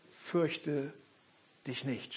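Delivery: a quiet, tender angel's reassurance; soft angel voice. Retold or imitated. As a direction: breathe it out gently like an angel. Quotes angels softly.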